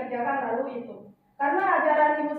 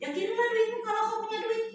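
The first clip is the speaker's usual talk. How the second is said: teasing mother; 8 semitones higher, 3 dB quieter than usual. Retold or imitated. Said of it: "Lalu dia bilang".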